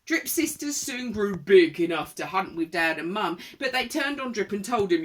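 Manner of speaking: lively, projected read-aloud; rhythmic storybook telling; children's story narration. A narrator's reading. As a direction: read it to small children with relish. Reads a picture book.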